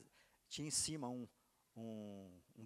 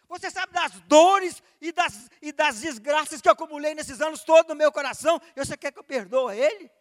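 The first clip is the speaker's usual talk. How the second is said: furious shouted protest, much higher and louder; angry outburst; 20 dB louder than usual. Retold or imitated. Son refusing to forgive his father, shouting in anger. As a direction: hurl the questions back in outrage. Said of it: "Nessa hora eu fiquei bravo".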